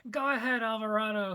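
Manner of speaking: high, loud defiant scream; daring his enemy to fire. Acted. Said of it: "he screamed out".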